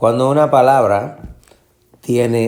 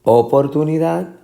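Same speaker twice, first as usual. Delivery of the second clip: Oportunidad with O punched, higher pitch; misplaced first stress; 6 semitones higher than usual. Shown as a demonstration. Teacher asking which is right, O-portunidad. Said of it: "la fuerza de la pronunciación".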